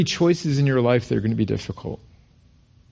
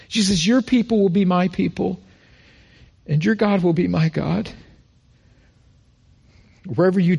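preacher reading Ruth's vow, rising with feeling; scripture reading; 3 semitones higher than usual. Acted. Preacher to church, reading Ruth's vow with fervor. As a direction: read the vow with building passion.

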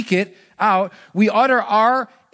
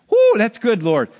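excited whoo; 4 semitones higher, 6 dB louder than usual. Retold or imitated. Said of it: "I'm just going".

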